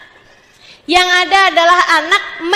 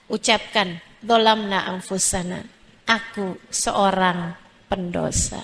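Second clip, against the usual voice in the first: repentant prayer; 4 semitones lower than usual. Acted. Preacher leading mothers in a prayer for forgiveness, low and humble.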